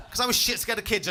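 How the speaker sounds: ordinary talk, nothing marked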